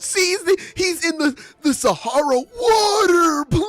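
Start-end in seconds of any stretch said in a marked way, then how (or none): 2.6-3.7 high, loud begging; hammed-up parched cry; mock desperate plea. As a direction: beg like you're dying of thirst. Acted dying of thirst in desert.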